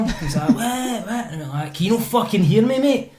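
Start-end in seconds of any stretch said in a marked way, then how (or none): 0.5-1.3 high-pitched mock "what?"; deaf man imitation. Deaf man answering angry car owner, high uncomprehending what. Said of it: "he was actually deaf". 1.7-3.1 higher-pitched exasperated question; retold exasperation. Car owner challenging deaf man, exasperated retold demand.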